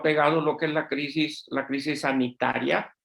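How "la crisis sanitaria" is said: ordinary talk, nothing marked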